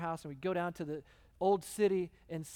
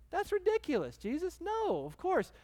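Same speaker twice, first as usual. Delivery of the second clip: much higher, mock disbelief; a crowd answering Jesus with amused disbelief; incredulous scoff; 12 semitones higher than usual. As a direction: scoff as if the idea is absurd. Listeners scoff at Jesus' question.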